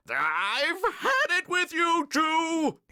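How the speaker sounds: very high, loud, drawn-out yell; furious shout. A narrator's reading. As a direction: explode like a principal at the end of his rope. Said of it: "shouted Mister Krupp".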